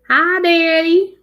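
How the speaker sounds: light up when Dad joins; excited greeting. Real, not acted. Daughter spotting her dad in the live chat, greets him high and loud.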